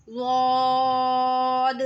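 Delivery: रौद held long and thick; heavy long zaad. Shown as a demonstration. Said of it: "और ये भी मोटा अदा होगा". (रौद मोटा और लंबा)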